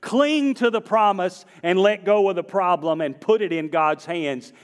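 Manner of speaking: high, driving pulpit delivery; forceful preaching. Real, not acted. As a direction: drive the message home to the congregation.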